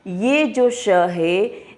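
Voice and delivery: very slow ये जो श है; slow letter demo. Shown as a demonstration. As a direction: linger on श to show the palate sound. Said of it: "तालु से उच्चारण".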